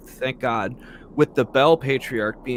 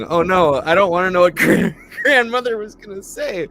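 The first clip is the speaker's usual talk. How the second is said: horrified laughing; 7 semitones higher, 8 dB louder than usual. Real, not acted. Dreading grandmother's words.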